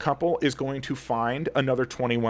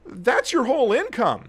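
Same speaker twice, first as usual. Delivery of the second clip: getting heated; 8 semitones higher than usual. Real, not acted. Vlogger venting to viewers about premiums, heated and raised. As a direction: let the frustration boil over on the money. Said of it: "And I'm getting a little heated".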